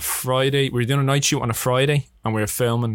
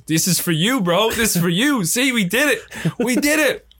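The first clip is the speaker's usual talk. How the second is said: higher, rising higher still into mock elation; imitated excited triumph; 9 semitones higher, slower than usual. Director hugging him over the surprise Nando's, imitated triumphant excitement. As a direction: act out an overjoyed friend presenting a gift.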